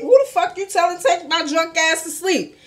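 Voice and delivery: high, loud mocking echo of the drunk line; incredulous. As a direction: repeat it back in outraged disbelief.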